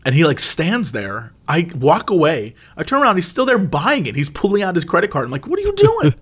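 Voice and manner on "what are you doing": exasperated disbelief. Retold or imitated. Exasperated at dad buying pie.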